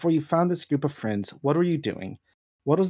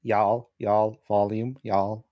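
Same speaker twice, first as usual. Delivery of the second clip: put-on Southern accent; 4 semitones lower than usual. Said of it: "Do a light Southern accent".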